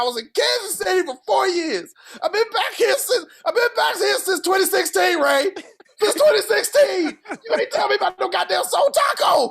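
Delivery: hammed-up rant at Ray; mock exasperated rant. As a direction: yell it like a wronged friend. Ranting at friend over tacos.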